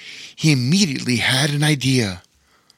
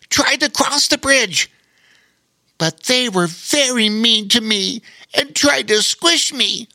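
much higher spider voice, matter-of-fact; frank spider voice; 9 semitones higher than usual. Acted. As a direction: tell it plainly, as the little spider. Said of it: "said Darwin in a frank manner".

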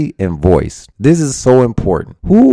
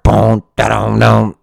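beatboxed beat; slower than usual. Shown as a demonstration. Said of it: "this Savage beat is hard".

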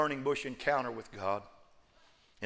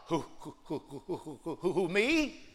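stammering Moses; 6 dB quieter than usual. Acted. Moses stammering, scared of God's call.

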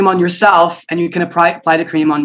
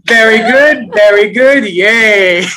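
cheering; 5 semitones higher, 4 dB louder, much slower than usual. Real, not acted. Teacher cheers after exercise.

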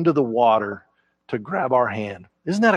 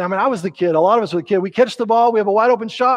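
ordinary talk

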